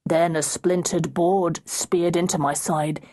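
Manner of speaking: plain reading voice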